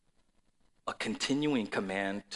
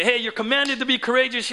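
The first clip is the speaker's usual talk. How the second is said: preaching at full force; 10 semitones higher, 8 dB louder than usual. Acted. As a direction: build the sermon to a booming peak. Preacher voicing his own command.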